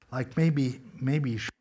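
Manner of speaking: normal talking voice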